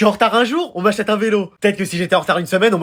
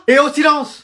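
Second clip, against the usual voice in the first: higher teacher's call; calling for silence; 6 semitones higher, slower than usual. Acted. Teacher to gossiping class, calling for silence. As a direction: cut through the chatter, pitched up.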